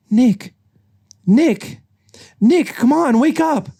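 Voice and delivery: high, loud, climbing calls; insistent waking call. Acted. Judy waking sleeping Nick.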